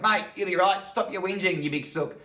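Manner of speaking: loud, high, hearty brush-off; acted no-nonsense Aussie father; brusque dad. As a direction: chivvy a crying kid back onto the bike. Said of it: "He's like".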